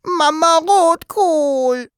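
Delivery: put-on very high, slow childlike wail; plaintive, drawn-out high cry; tearful whimper. A narrator's reading. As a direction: whimper it out, drawing each word long. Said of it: "begann zu weinen und wimmerte".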